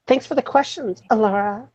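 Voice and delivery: high, soft eerie doll voice; creepy doll voice. Acted. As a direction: coo it like a haunted doll. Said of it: "Why are you saying it in a creepy doll voice".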